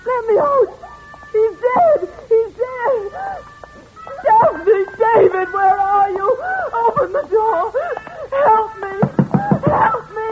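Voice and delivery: high, frantic calls for help; terrified screaming. Acted. Amanda locked in beside the dead man, frantic high cries for help. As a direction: scream for anyone to open the door.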